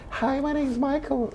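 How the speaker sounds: put-on high, soft, slow breathy-free boyish voice; tiny gentle high voice. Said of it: "But in public".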